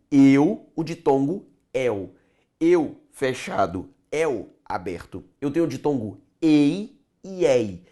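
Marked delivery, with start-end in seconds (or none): 2.6-5.2 Eu with closed e, el with open é, slowly; closed vs open vowel. Shown as a demonstration. Teacher to class, contrasting closed and open vowels. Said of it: "fechado ... aberto".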